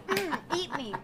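ordinary talk

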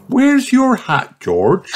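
high, slow motherly pig voice; lightly voiced mother pig asking; Mummy Pig voice.

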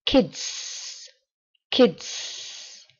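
put-on "kids" with a long hissed S at the end; long final S. Shown as a demonstration. Teacher drilling learners on "kids" with a long final S. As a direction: stretch the S of "kids" out. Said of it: "pronunciar la S al final así tan alargada".

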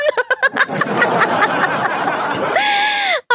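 long squealing cackle; high cackle trailing off; high shrieking laugh. Real, not acted. Laughing after taunt.

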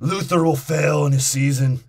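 loud, hammed-up movie villain line; mock dramatic announcement. Mimicking Stringer Bell's lines.

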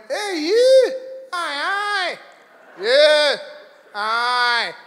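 put-on high, loud drunken Scottish calls; imitated drunk Scot, disjointed shouts; drunk Scottish heckler. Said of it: "He was so drunk".

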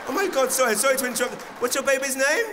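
put-on high, loud gushing over a baby; comic fussing over the baby, high and loud; gushing host. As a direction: coo over the baby to stall a dying gig. Imitating host distracting with baby.

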